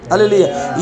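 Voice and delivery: usual speaking voice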